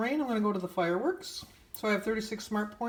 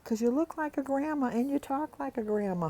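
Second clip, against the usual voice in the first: a higher, lightly voiced little girl; 8 semitones higher than usual. Little girl explains her guess to the neighbor, lightly voiced. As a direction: give it a light childlike lift. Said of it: "And I go".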